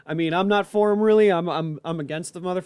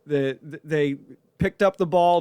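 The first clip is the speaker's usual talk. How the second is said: ordinary talk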